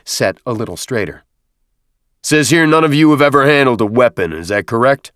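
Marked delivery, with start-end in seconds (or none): 2.2-5.1 loud, projected questioning; raised drill-instructor query; sergeant's challenge. A narrator's reading. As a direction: put it to the recruits in a raised voice. Sergeant addressing called-out recruits.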